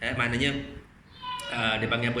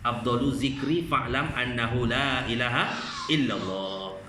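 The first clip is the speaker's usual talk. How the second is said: Arabic recitation; 3 semitones lower, slower than usual. Acted. Quotes Arabic hadith formula.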